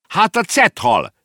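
high, loud, drawn-out cry; shouting down. Acted. Girl in the tree to the boy below, shouting down. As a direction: call it down slowly from up high. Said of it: "kiáltott le a lány".

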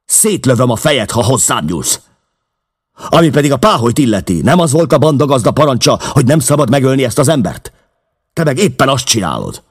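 high, loud, fast furious threat; enraged yelling; angry shouting. A narrator's reading. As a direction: shout him down at gunpoint. McMurdo threatens with pistol.

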